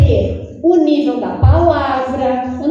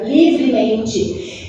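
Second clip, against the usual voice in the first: ordinary talk, nothing marked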